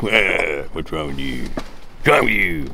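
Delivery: put-on scolding voice, drawn out at the end; mock telling-off, stretching the last line; Norman scolding. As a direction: imitate an exasperated scold. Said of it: "Norman scolds me and gives me the Norman look".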